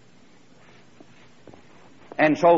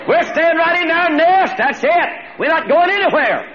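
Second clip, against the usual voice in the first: put-on squeaky baby bird digging in its heels, much higher and louder; stubborn little eaglet; 8 dB louder than usual. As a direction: plant your feet like a pouting chick who won't budge. Eaglet refuses to leave nest.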